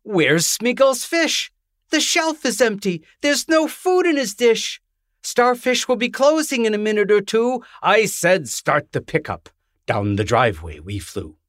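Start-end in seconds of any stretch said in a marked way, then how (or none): 0.0-9.5 high, urgent worried voice; Valerie crying out. A narrator's reading. Valerie discovering the empty fish shelf, high alarmed cry. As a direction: squeal it out in alarm, much higher. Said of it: "Then Valerie cried".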